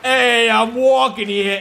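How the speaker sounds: loud, slow, hammed-up New York holler; New York accent. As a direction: bark it like a classic cabbie New Yorker. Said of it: "I love New York accents".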